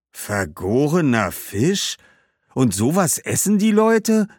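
hoch und laut, entsetzt gerufen; ungläubig und angewidert ausrufen. Acted. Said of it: "riefen Saponibal und Hannibal entsetzt". (die Kinder hören von der Fischsoße, entsetzt)

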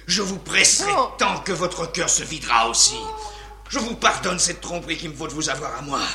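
rapid, pressing words; urgent and fast. Acted. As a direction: rush the plea, hungry. Bouffon pressant la reine. (débit pressé et fiévreux)

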